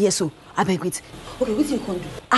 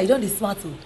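normal talking voice

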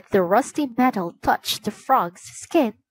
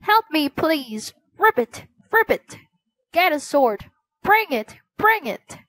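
croaking frog voice; 7 semitones higher than usual. A narrator's reading. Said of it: "the frog croaked again".

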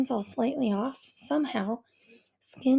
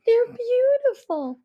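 higher, slow, teary admiration; tearful tender mother; 11 semitones higher, 7 dB louder, slower than usual. A narrator's reading. Mother admiring her daughter's ice tears, teary and tender. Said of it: "mother admires icy tears".

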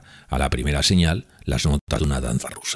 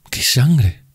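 startled question; 11 semitones higher, 7 dB louder than usual. Acted. Alarmed question.

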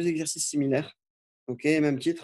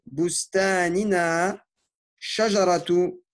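slow, deliberate dictation of Arabic words; careful teacher dictation with pauses between words; much slower than usual. Shown as a demonstration. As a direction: dictate each word slowly so students can write it. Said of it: "l'autodictée que je vous lis".

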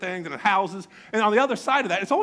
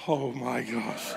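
slow, low, hushed gasp of words; mock disbelief; 8 semitones lower, 6 dB quieter, much slower than usual. Onlooker reacting as boy greets wrong girl, hushed. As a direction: draw it out softly, low in the voice.

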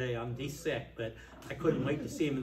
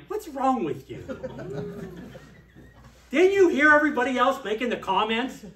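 loud, higher, scolding retelling; exasperated rebuke; 7 semitones higher, 9 dB louder than usual. Retold or imitated. To Lou in the steering meeting, a loud exasperated scolding. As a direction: blast him with frustration. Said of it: "and I said".

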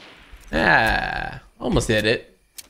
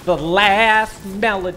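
put-on voice; 5 semitones higher, much slower than usual. Echoing his own joke.

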